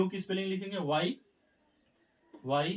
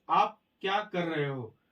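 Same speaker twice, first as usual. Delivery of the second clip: slow example read; slower than usual. Shown as a demonstration. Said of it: "first example देखेंगे".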